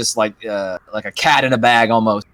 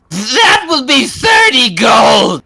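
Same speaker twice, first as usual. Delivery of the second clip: slow, loud, high witch demand; 12 semitones higher, 7 dB louder, much slower than usual. Acted. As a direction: point and demand the money. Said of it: "she's like".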